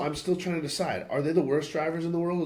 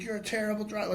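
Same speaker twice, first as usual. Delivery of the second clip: retold yell in a raised pitch; mock yelling; 7 semitones higher, 4 dB quieter, faster than usual. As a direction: scold like an irate driver, volume held back. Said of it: "yelling at me and shit".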